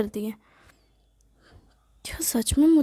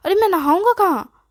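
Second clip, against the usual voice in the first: whiny protest; 6 semitones higher, 5 dB louder than usual. Acted. Son whining excuses to mother.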